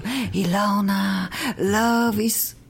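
slow, lightly put-on mimic of a friend's earnest speech; imitating Davud. Host teasingly imitates guest Davud's slow speech. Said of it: "I can even talk like you".

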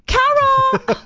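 put-on wailing Australian girl, very high and loud; Phoebe, Australian accent. Girly Australian friend walking in pained after a wax, hammed-up wail. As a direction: cry out the name in a hammed-up Aussie whine. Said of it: "I will do my Australian accent for you".

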